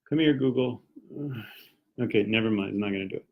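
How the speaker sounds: ordinary talk, nothing marked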